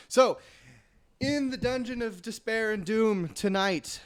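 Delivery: big booming show intro; announcer voice. Acted. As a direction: hype up the title like a host. Announcing the show's title.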